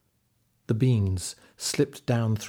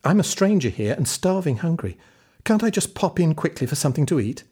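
put-on pleading boy; 6 semitones higher, 4 dB louder, faster than usual. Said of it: "Jack begged".

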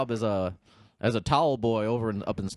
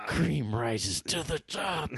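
slow wrestler catchphrase; 3 semitones higher, 4 dB quieter, much slower than usual. Retold or imitated. Macho Man catchphrase impression.

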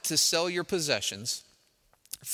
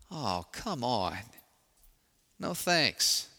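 grumbling refusal; much slower than usual. Acted. Moses refusing God's task.

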